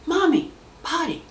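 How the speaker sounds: put-on high, insistent little boy calling his mommy; child calling. Toddler tugging at mom in church, calling high. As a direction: pipe it up like a desperate toddler.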